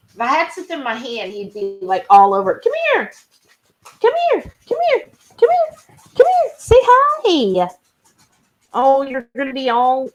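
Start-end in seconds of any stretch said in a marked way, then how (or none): 2.6-7.7 very high, repeated little calls; coaxing pet call. Real, not acted. Calling pet to say hi, very high coaxing. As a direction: coax a pet over, pitched way up.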